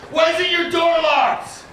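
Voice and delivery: very high, slow shouted demand through laughter; mock cop shout again. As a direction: yell it like the annoyed cop, laughing. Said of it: "And then he goes".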